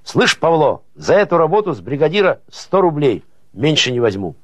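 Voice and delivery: a narrator's moderate shout; calling out. A narrator's reading. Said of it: "закричал".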